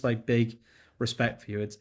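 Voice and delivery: ordinary delivery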